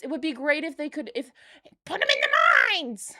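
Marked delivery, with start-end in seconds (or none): 1.8-3.1 high, loud gleeful shout; excited shout. Real, not acted. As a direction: blurt it out gleefully. Joking exclamation.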